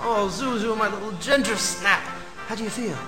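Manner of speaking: put-on soft, low loving words to his child; tender father. George greeting his little daughter, soft tender voice. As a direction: hold her close with your voice.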